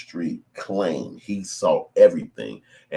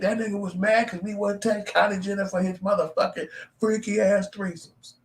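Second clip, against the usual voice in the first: mocking high voice. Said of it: "They'd have been like".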